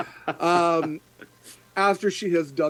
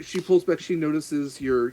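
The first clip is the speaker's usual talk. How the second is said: ordinary talk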